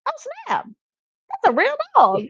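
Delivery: high-pitched mock astonishment; startled stranger. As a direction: imitate a kid's amazed outburst. And he goes.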